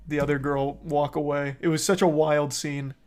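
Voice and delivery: usual speaking voice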